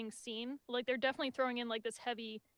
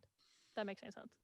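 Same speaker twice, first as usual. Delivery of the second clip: whispered aside; 3 semitones lower, 11 dB quieter than usual. Real, not acted. Aside after explaining plan.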